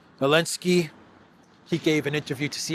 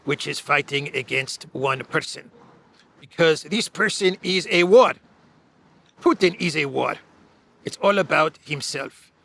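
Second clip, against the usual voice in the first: Zelensky voice; 2 semitones higher, 4 dB louder than usual. Retold or imitated. Podcaster reading Zelensky's CNN quote in a mock Ukrainian accent. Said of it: "I'm going to read this in my Zelensky voice".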